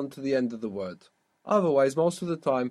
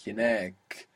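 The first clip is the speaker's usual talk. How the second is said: Hinek with a long, stressed NEK; stress on last syllable; 3 semitones lower than usual. Shown as a demonstration. Teacher showing what attracts the accent, stress on NEK in Hinek. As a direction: lean on the long final vowel of Hinek. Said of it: "that attracts the accent".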